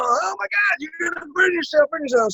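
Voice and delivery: high alarmed mock mom cry; mother warns little boy at candle, high alarmed cry; panicked parent. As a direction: squeal it like a frightened mother. Parent warning child about candle.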